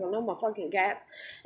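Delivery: normal talking voice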